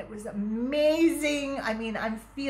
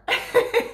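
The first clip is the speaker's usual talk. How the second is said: loud, much higher laugh; high burst of laughter; 13 semitones higher, 6 dB louder than usual. Real, not acted. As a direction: break into a bright laugh. Laughing with the stranger.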